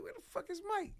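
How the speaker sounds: high exasperated question; a hint of real annoyance; frustrated. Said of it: "So I'm getting frustrated".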